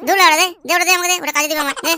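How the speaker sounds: loud, insistent demand; heated grab for it, starting very loud; angry demand. Acted. Quarrel over the found pipe.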